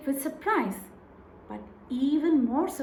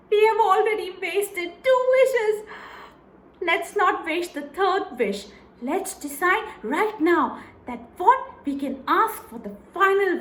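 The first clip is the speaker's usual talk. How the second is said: higher, louder, eager haggling; greedy scheming wife; 5 semitones higher, 4 dB louder than usual. Acted. Wife greedily planning the last wish, higher and louder. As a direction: talk yourself up to ever bigger riches.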